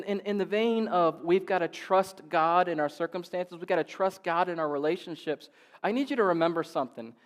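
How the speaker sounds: ordinary talk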